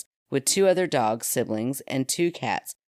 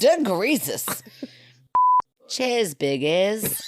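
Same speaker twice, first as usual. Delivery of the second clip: put-on Australian accent; 6 semitones higher, much slower than usual. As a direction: drawl it like an Aussie. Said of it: "was that not Australian accent".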